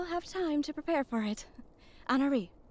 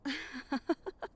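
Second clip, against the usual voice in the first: short laugh; 2 semitones higher, 4 dB quieter than usual. Acted. Character laughs after a remark.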